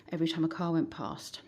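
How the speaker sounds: ordinary talk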